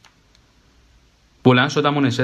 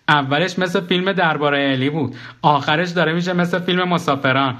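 lightly voiced, higher bewildered narrator-self; confused dead man; 6 semitones higher than usual. The dead man tells Karim his life became a film, a bit higher and bewildered. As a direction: lift the voice, half joking, half lost.

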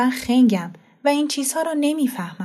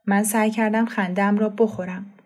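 ordinary delivery

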